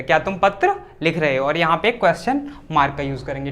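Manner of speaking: ordinary talk, nothing marked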